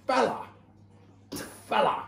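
high-pitched mock Brooklyn accent; Brooklyn accent impression. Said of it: "I love his accent".

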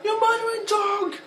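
very high, loud mock outrage; Mister Potato Head impression. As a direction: scream it at Woody. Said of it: "and he was like".

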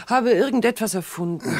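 a frightened, rising confession; anxious outburst. Acted. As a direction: blurt out the admission in panic.

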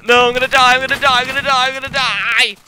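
high, loud repeated wail; hammed-up shrieking despair; panicked wail. Real, not acted. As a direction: wail in melodramatic terror. Trapped, about to die.

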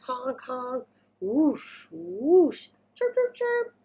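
honks, whooshes and high chirps; park noises. Acted. Reader voicing noises at the slide, playful. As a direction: paint the noisy park. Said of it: "There's all the noises".

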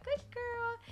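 very high dog-praise voice; animated praise. Shown as a demonstration. Owner showing praise that dogs love, very high voice. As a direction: pitch it way up to delight a pet. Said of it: "they love that".

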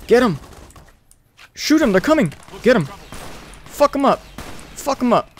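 high, loud shouts urging the fight on; rally the companions mid-firefight; battle yells. Real, not acted.

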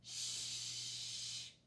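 whispered sharp shush. Acted. An unseen reader shushes the noisy Bear in a whisper. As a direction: hush them from behind the shelf. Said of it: "said a voice".

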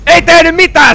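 loud, high angry yell; furious protest. Real, not acted. Player whose shout did nothing, frustrated yell. Attack had no effect.

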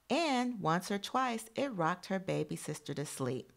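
put-on storybook voice for young listeners; reading to children. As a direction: read the picture book out to the class. Reads picture book to class.

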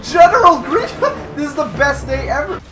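high, giggly delight; laughing fanboy. Pretend. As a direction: laugh it out with glee.

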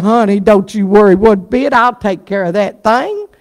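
pat him on the head with your voice; soothing wife. Acted.

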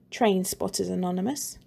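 plain reading voice

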